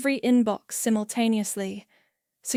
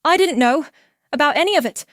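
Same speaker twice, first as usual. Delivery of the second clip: high, loud, distraught confession; tearful; 6 semitones higher, 7 dB louder, faster than usual. A narrator's reading. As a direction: blurt it out as if bursting into tears. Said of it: "Elise suddenly bursts into tears".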